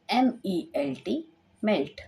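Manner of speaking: normal talking voice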